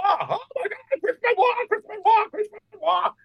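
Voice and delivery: very high falsetto fan squeal; mock frenzy. Mimicking people's frenzy over the video.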